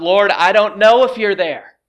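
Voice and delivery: loud, high plea to God; doubting cry for help; crying out in prayer. Acted. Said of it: "Often we cry out".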